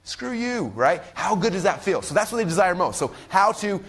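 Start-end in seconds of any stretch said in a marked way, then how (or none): 0.0-0.7 high, soft, smug kiss-off; higher and softer gloating at the old coworkers; gloating retort. Retold or imitated. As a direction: rub it in with a triumphant sneer. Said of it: "what do I tell them?".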